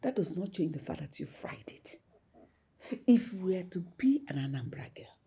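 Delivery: whispered scolding mother; disapproving whisper. Acted. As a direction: hiss the disapproval under breath.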